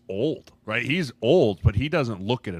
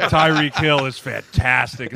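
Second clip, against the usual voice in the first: mock starry-eyed receiver; 3 semitones higher, 8 dB louder, slower than usual. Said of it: "Who's your favorite guy in the league?".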